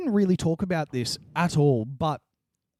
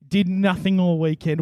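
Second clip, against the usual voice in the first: ordinary delivery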